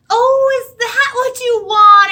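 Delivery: high, loud, slow delighted realization; surprised librarian. Acted. Librarian finally understands the hen, high, loud and slow. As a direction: gush it as the penny drops. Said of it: "asked the librarian".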